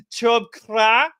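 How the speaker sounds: slow, high-pitched attempt at German accent; hammed-up, badly faked German accent. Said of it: "trying to do the German accent".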